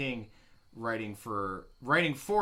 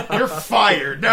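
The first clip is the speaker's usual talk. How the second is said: loud joking firing; mock angry; 6 semitones higher, 14 dB louder than usual. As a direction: bark it in mock fury.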